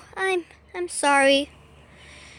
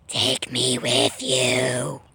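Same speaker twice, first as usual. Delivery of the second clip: mock deep plea; much slower than usual. Poplio pleads.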